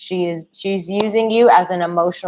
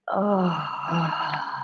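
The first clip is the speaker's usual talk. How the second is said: choked-up cry; 7 dB quieter than usual. Real, not acted. Emotional address to viewers.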